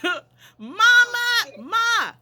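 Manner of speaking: retold yell. Girl yelling to her mother about the spit, laughing in disgust. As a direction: shriek for Mama through a laugh. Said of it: "And I was yelling".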